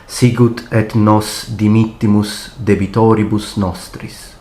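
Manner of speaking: steady, reverent Latin prayer read at full speed. Shown as a demonstration. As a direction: recite the prayers as a flowing model read. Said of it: "the first time I will read the prayers in full speed".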